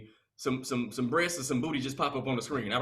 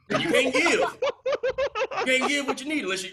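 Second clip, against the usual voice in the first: laughing through it; 10 semitones higher, 5 dB louder, slower than usual. Retold or imitated. Drunk dad advice laughing.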